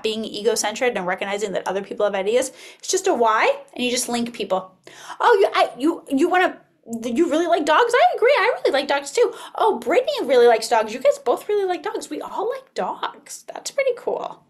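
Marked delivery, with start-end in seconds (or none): 5.2-14.4 acted child chatter. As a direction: play an eager child finding common ground. Teacher acts child agreeing chatter.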